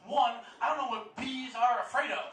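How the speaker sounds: normal talking voice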